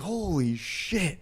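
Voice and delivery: stunned. Retold or imitated. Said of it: "I was like".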